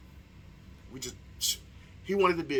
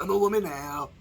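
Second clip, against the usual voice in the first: higher, louder put-on woman voice; mock woman voice; 4 semitones higher, 7 dB louder than usual. Vlogger retrying after a cough, mock woman voice. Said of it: "in my woman voice".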